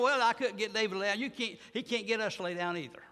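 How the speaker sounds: usual speaking voice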